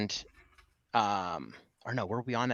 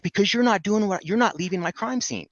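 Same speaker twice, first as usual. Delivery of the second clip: high, loud mock yell; retold yell, pitch well up; imitated yelling; 11 semitones higher, 6 dB louder than usual. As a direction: imitate an officer yelling back an answer. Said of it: "Why are you so yelling".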